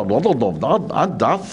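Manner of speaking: repeated dad, deep in the throat; Arabic dad sound. Shown as a demonstration. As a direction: drill the letter dad again and again. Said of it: "dia memang dad".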